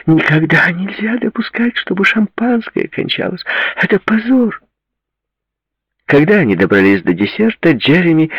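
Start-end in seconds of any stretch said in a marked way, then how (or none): 0.0-4.6 very high, theatrical indignation; high-pitched dramatic complaint; moaning outrage. A narrator's reading. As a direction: lament it like a scandalised socialite. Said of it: "простонала Ширли".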